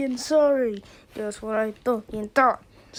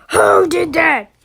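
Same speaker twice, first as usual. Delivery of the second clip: louder, sharp demand for the culprit; 3 semitones higher, 9 dB louder than usual. Acted. Teacher yelling at the class to confess. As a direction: bark the question at the class.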